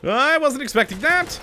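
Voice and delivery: sudden startled shout; jump-scared yell. Real, not acted.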